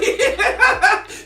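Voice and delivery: high, loud laughing fit; burst of laughter. Real, not acted. Laughs inside the punchline.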